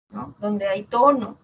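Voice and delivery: ordinary talk, nothing marked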